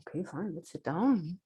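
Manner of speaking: low, soft, guarded okay; hushed reluctant yes; wary agreement. Retold or imitated. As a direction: agree warily, under your breath. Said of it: "I'm like".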